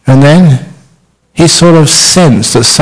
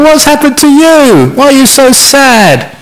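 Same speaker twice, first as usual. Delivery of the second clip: much higher, cheerful fat spider voice; jolly fat spider; 12 semitones higher than usual. Acted. Fat happy spider asks the sad spider, high and jolly. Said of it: "the big fat Johnny Spider said".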